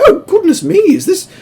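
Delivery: very high, fluttery and surprised; prim carriages twittering in alarm; fussy old coaches. Acted. Coaches realizing Mavis is pulling them, very high and fussy. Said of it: "both the coaches are just like".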